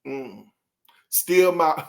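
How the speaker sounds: usual speaking voice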